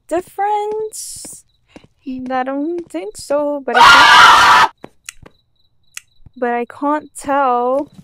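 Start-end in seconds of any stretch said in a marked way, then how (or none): 3.6-5.0 sudden loud unvoiced shriek; real frightened yell; startled scream. Real, not acted. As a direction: scream as the scare hits. Jump scare mid-sentence.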